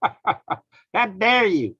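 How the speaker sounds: very slow, high, dragged-out scolding; Greta impression, slow. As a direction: stretch every word in mock outrage. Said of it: "Greta going".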